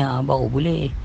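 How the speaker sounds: ordinary talk, nothing marked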